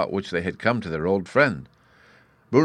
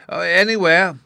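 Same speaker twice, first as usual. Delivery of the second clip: high, loud, excited cry; loud and high, in a fluster; excited shout; 12 semitones higher, 9 dB louder than usual. A narrator's reading. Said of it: "shouted the excited professor".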